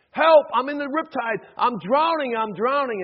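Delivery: loud, high cry from the water; acted urgent distress call; calling for help. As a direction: shout for rescue as if drowning. Acts drowning swimmer calling for help.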